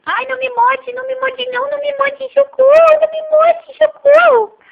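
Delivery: high, playful fake panic, pleading not to be bitten; mock fright. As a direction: squeal pretend help cries at the baby. Mother pretends baby bites her. (mãe fingindo medo do bebê que morde, gritinhos de brincadeira)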